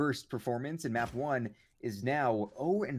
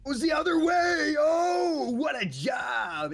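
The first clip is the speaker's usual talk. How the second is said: caster's roar, much higher and louder; explosive play-by-play yell; excited shout; 6 dB louder, much slower than usual. Real, not acted. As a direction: scream it as the shot lands. Big play in the match.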